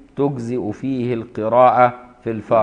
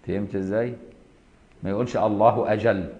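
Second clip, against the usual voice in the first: normal talking voice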